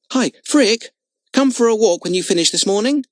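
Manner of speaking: high, loud call across the camp. Acted. Jimmy calls across to Frick, friendly loud call. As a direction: shout over to a friend nearby. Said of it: "Jimmy called across to Frick".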